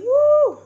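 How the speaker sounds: very high, loud whoop; celebratory whoop. Real, not acted. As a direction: let out an excited party whoop. Whoop ending the holiday cheer.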